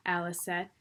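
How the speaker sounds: ordinary delivery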